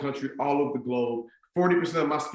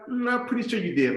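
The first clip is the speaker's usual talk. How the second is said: higher-pitched insisting mom; mother in denial; 8 semitones higher than usual. Retold or imitated. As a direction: imitate mom refusing to believe it. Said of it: "My mom was in denial".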